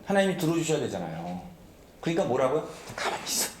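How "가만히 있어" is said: whispered order to stay still; hushing command. Acted. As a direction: hush someone under your breath. Voices God telling Moses to hush. (속삭이듯 조용히 제지하며)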